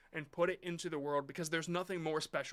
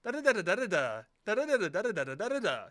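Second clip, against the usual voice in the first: da-da syllables, each phrase falling at the end, a bit louder; mocking AI narrator cadence; 3 semitones higher, 5 dB louder, faster than usual. Mocking the AI voice-over to the chat, sing-song falling da-da. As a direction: hum the same dropping tune again and again like a bored AI voice. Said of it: "goes like a downward inflection at the end".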